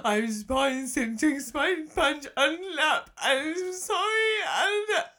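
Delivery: rising, slow tearful pleading; tearful child. Retold or imitated. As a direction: sob it out like a panicked kid. Crying child apologises to mum.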